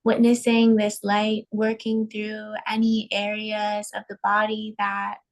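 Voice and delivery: slow, spacious meditation voice with long pauses; unhurried, evenly pitched breathing guidance; guided meditation. Acted. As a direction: guide listeners calmly into each breath.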